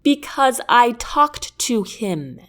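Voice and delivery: slow, each word placed; measured and deliberate; crisp, careful, convincing; with precision. Acted. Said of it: "I say with precision".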